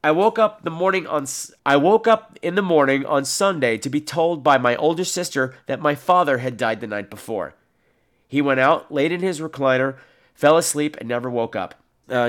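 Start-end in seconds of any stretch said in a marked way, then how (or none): none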